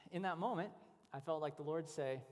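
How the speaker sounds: normal talking voice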